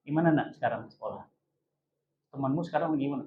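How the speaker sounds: very soft, low fatherly murmur; tender fatherly chat. Acted. Father asks his child about school and friends, tender. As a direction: ask quietly, as a father drawing a child out.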